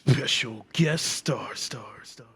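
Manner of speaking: announcer's echo trailing off into a whisper; fading echo. Pretend. Host hyping the special guest star, fading echo. Mimics an echoing announcer intro.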